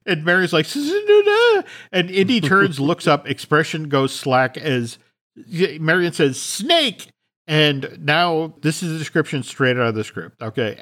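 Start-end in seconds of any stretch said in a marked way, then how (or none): 0.7-1.6 high, loud panicked stammer; high frightened stutter; terrified stammer. Retold or imitated. Marion sees the giant snake. 6.4-7.1 warning cry. Retold or imitated. Marion warns Indy.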